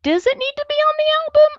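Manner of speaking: a nervous artist second-guessing her own track; mock anxious doubt. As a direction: squeak out the worry fast. Retold self-doubt about a song.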